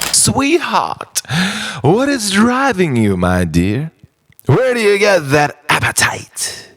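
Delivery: normal talking voice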